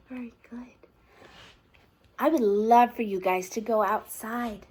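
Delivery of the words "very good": soft breathy well done; whispered praise. Acted. Reader whispers praise at the end of the story. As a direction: hush it like a secret.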